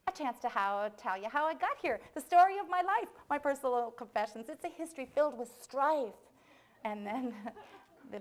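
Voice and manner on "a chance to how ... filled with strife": building higher and louder, hammed-up; melodramatic mouse. Condemned mouse begging to tell its life story, theatrical plea. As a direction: swell into a grand mock-tragic plea.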